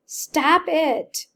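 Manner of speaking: high, loud gasping cry; gasped shout. Acted. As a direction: gasp it out loud. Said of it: "Johnny gasped".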